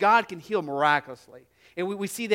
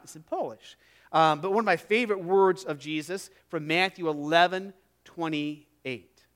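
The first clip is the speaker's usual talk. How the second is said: usual speaking voice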